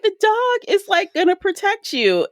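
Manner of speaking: mocking a character's logic about the dog, in a squeaky voice; mock high voice. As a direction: chirp it out like a cartoon. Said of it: "I'm like".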